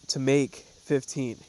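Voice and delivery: normal talking voice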